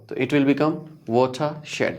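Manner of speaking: ordinary delivery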